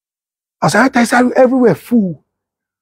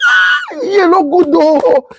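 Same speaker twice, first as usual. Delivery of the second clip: excited; 10 semitones higher, much slower than usual. Retold or imitated. Exclaiming about delegates.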